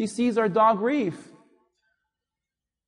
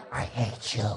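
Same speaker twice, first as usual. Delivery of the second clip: put-on sulky teenager; 12 semitones lower, 8 dB quieter than usual. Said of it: "And now they're like".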